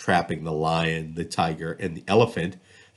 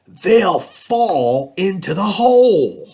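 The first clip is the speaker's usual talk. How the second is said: put-on gleeful scheming boy; 12 semitones higher, 7 dB louder, slower than usual. Bernie explains his trap.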